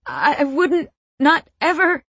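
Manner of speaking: high, halting protest; upset, stuttering denial; distressed stutter. A narrator's reading. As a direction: stammer it out, pitched right up. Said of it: "I stutter".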